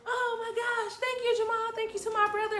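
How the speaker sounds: high-pitched, gushing imitation of thanks; giddy retold delight. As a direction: gush like an overjoyed little sister. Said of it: "laughing so hard 'cause I'm like".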